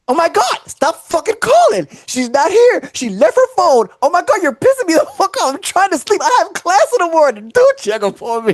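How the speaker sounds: high, irritated roommate yelling down the phone; angry roommate. Retold or imitated. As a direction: snap at the caller like a fed-up roommate. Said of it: "exactly what happened to me".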